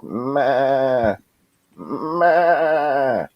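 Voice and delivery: high bleating "ma"; sheep bleat. Retold or imitated. Mother sheep at the house, bleating high. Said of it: "'cause they can't speak".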